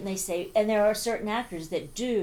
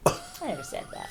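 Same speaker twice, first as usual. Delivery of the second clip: higher, half-laughing denial; mock indignant; 10 semitones higher, 4 dB quieter than usual. Real, not acted. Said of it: "reacting to the quote".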